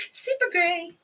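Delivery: put-on high bubbly valley girl; gushing valley girl tone. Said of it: "Sound like a valley girl".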